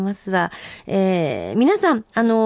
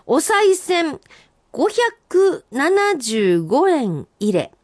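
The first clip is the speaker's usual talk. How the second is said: senryu recital; 6 semitones higher, much slower than usual. Acted. First reading of senryu.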